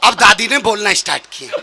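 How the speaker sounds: ordinary talk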